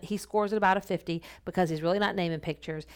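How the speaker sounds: ordinary talk, nothing marked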